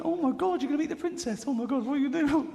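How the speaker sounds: high, excited flurry of office women; acted overexcited colleagues; excited gushing. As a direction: gush in a high, fluttery voice.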